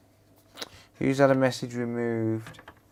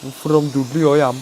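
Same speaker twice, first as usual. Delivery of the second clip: put-on broad Dudley accent, a touch louder; 6 dB louder, faster than usual. Streamer repeating the Dudley line in a Dudley accent. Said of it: "That's how they talk there".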